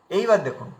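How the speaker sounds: ordinary talk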